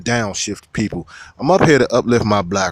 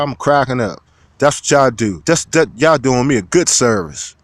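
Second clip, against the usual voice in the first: normal talking voice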